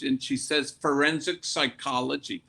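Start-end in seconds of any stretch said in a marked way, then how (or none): none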